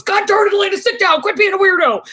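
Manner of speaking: high, loud scolding yell; exasperated dad. Retold or imitated. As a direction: snap at her like a fed-up father. Dad scolding daughter at cafe.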